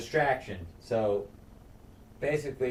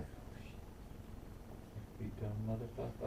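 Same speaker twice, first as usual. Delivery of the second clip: muttered curse; 17 dB quieter than usual. Retold or imitated. Lord hearing bad news.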